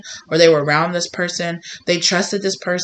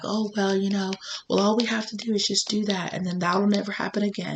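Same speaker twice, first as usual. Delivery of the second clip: higher, softer, offhand reassurance; breezy sneaky voice; 4 semitones higher, 8 dB quieter than usual. Acted. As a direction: sound casually dismissive, brushing it off. Voicing a sneaky person.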